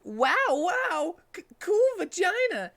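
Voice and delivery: excited squeaky yell; shouting Tails. Acted. Tails reacting to Cream, high excited shout. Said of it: "shouted Tails".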